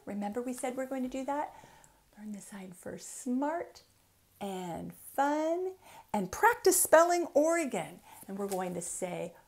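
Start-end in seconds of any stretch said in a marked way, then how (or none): 2.2-8.0 lilting, rhythmic picture-book reading; reading to children. Acted. Author reading her book aloud to children, lilting storytime. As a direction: read each rhyme like a bouncy storytime.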